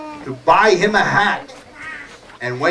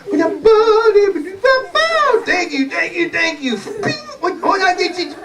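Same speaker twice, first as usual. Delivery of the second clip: high-pitched cartoon dog performer; show-off dog; 12 semitones higher than usual. Dog thanking his audience after flute playing, high showy voice. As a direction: play a high-voiced dog lapping up applause.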